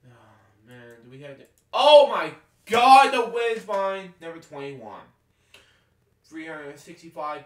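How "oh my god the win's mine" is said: loud, high, slow victory cry; real thrilled shout; excited shout. Real, not acted. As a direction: yell out the win in triumph. Finally wins the game.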